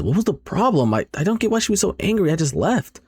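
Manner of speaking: high, rushed complaint; acted bewilderment. Friend wonders about the girl's anger, acted bewilderment.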